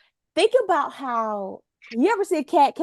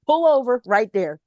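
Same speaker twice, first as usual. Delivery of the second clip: ordinary talk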